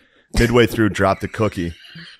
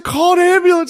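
very high urgent imitation; hammed-up alarm; mock panic; 4 dB louder than usual. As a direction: cry for help in a pitched-up voice. Retelling the panicked call for help.

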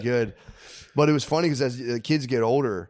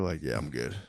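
mock dismissive mutter; 9 semitones lower, 9 dB quieter than usual. Mock refusal, much lower and quieter.

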